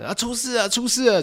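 high mock shout of trouble; shouting alarm. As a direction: call out that something's wrong, pitched high. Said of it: "有人开始大喊". (高声模仿大喊“出事了”)

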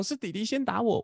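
put-on the boy insists his brother hit first; imitating son. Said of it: "然后他就说".

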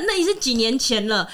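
usual speaking voice

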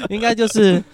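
helpless high-pitched laughter in the words; cracking up. Real, not acted. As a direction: blurt it out through big laughs. Joking about dad, cracking up.

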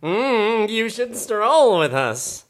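high, loud, slow snooty drawl; snooty peacock. Acted. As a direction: look down your nose as the peacock. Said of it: "the tiger should be more snooty".

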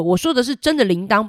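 plain reading voice

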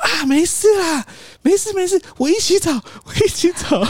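high, squeaky smoothing-over voice; placating boss. Retold or imitated. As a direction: act the boss hastily calming everyone down. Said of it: "突然就说".

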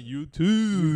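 YOUTUBE with TUBE stretched long; show-off pronunciation. Shown as a demonstration. Co-host teaching how to show off, YOUTUBE with a long 'tuuube'. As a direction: draw out the 'oo' in YOUTUBE slowly. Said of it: "以后大家要学会装逼的话".

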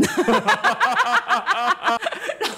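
long high falling laugh; laughing at the health bureau visit. Real, not acted.

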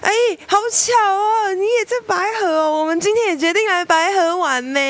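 very high, sing-song fake surprise; family bumping into the sister, faking innocence; mock innocent. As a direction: play dumb, sweetly overacted. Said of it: "我们统一的回答是什么".

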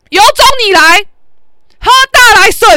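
high, full-volume challenge; loud dare. Retold or imitated. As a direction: shout him down and dare him. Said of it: "我就这么大声". (大声叫嚣挑衅)

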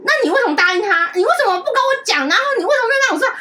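high, loud imitation of an angry mother; 模仿妈妈骂人. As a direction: fire off accusing questions like a scolding mom. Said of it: "我妈就转过来骂我". (学妈妈高声质问、责骂)

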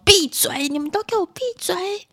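high, exasperated order; irritable mother. Acted. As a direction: act a mum silencing all the kids. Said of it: "暴躁的妈妈就说". (暴躁妈妈高声命令)

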